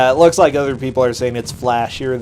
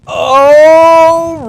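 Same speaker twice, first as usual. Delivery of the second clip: sudden high, loud delighted cry; excited shout; 12 dB louder than usual. Real, not acted. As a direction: burst out with glee. Sudden excitement at game screen.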